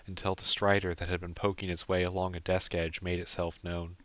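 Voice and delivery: ordinary delivery, nothing marked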